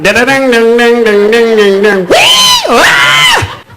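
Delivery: cartoon panic, yelping; panicked noises. Panic sound effects.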